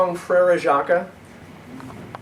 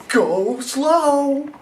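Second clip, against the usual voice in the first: higher, cautioning mother voice; Mom warning; 11 semitones higher, 5 dB louder than usual. Acted. Mom warns Fred before sledding, higher cautious voice. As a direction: warn him like a careful mom. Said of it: "Mom said".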